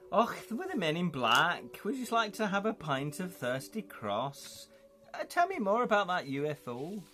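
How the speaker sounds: put-on lifted, comically poor Scots accent; terrible Scottish accent. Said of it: "That's a terrible Scottish accent".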